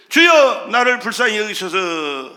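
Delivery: loud burst on the first word, easing after; crying out. Retold or imitated. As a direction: imitate a beggar's shouted plea. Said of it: "소리 질러 가로되".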